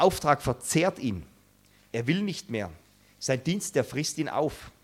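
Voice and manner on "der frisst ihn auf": ordinary delivery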